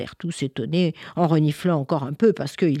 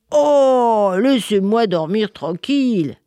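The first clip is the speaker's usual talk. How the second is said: put-on loud grumbling old figure; grumpy winter; 4 semitones higher, 4 dB louder, slower than usual. Winter woken by children, loud and grumpy. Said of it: "pas très content l'hiver".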